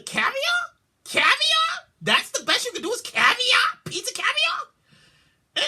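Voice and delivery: high, outraged question; can't believe what you're reading; squealing disbelief; shocked disbelief. Real, not acted. Outraged disbelief at pizza caviar.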